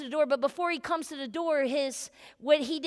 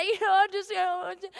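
mock defeated sigh; 3 semitones higher, faster than usual. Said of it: "He could have sat there and said".

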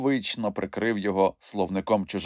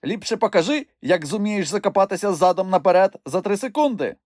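put-on higher, cheeky child's dare; mock challenge; 11 semitones higher, 3 dB louder than usual. A narrator's reading. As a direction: egg him on slyly. Moomintroll voiced, teasing. (зухвалий виклик)

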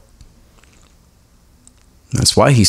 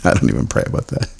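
fast, softer whispered joke; whispered aside; 7 semitones lower, 5 dB quieter, faster than usual. Real, not acted. Pastor confessing about the Dodgers, in a quick whisper. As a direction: toss it off under your breath.

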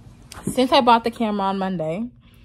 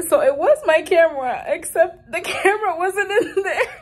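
high, breaking into laughter mid-sentence; laughing in disbelief; 8 semitones higher, 4 dB louder than usual. Real, not acted. Telling viewers the camera was missing, laughing in disbelief. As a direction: fight the laugh as you break bad news. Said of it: "I don't know why I'm laughing".

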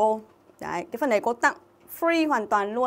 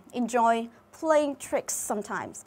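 slow phrase reading; much slower than usual. Shown as a demonstration. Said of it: "teacher repeats a phrase slowly".

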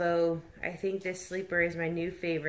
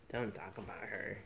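low mock warning; 9 semitones lower, 14 dB quieter than usual. Real, not acted. Mock possessive retort.

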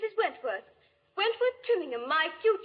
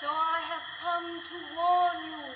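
very slow, eerie ghost voice; ghostly warning; 4 dB quieter, slower than usual. Acted. Ghost warns Wentworth to leave, slow eerie voice. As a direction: stretch each word like a spirit.

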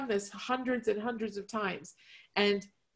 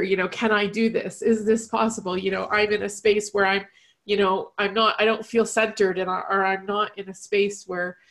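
emotional; 9 dB louder than usual. Real, not acted. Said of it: "forgive me if I get emotional".

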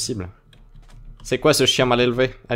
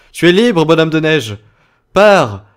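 dramatic farewell; 3 semitones higher, 8 dB louder, slower than usual. Acted. Releasing the snowman.

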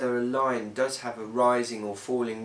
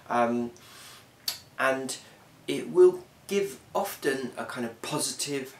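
ordinary talk, nothing marked

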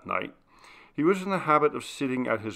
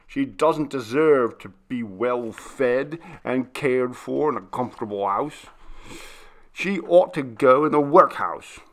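grind out each word in contempt; indignant scorn; 2 semitones higher, 6 dB louder than usual. Acted. The butler scorning the maid to his master, indignant. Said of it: "Skegg exclaimed indignantly".

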